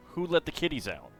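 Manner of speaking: ordinary delivery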